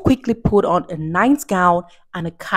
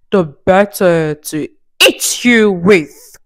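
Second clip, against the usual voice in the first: slow, drawn-out menacing wolf; wolf's cry; 3 dB louder, slower than usual. Acted. The wolf revealing himself to Little Red, slow menace. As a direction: savor each word before pouncing. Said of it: "cried the wolf".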